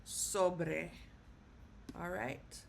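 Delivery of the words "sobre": "sobre" with the first syllable stressed, SO-bre. Shown as a demonstration. Teacher demonstrating where stress goes, "sobre" stressed on SO. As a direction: punch the O in "sobre". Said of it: "stress is gonna go on the O".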